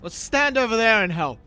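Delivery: loud, commanding burst; intimidating loud command; forceful order. Acted. Character orders the intimidated porter away.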